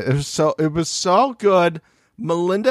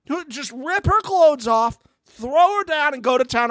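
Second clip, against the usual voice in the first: hyped-up egging on; 14 semitones higher than usual. Pretend. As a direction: cheer him on like a wild fan. Egging on in put-on voice.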